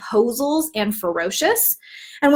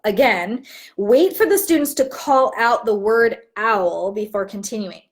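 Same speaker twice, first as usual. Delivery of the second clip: ordinary talk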